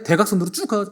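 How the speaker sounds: ordinary talk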